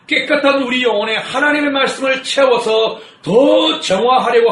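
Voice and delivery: loud, emphatic pulpit delivery; preaching. Acted. Pastor warns the congregation, forceful preaching.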